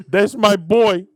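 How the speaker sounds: Italian dad. Pretend. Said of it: "is this how Italian men".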